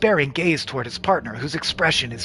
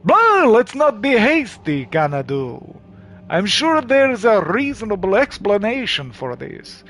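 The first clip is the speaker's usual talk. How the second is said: Cowardly Lion voice; 4 semitones higher than usual. Acted. El Hinto calms Xanadu, in a Cowardly Lion voice. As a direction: wheedle like a timid lion. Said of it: "Oh, Cowardly Lion?".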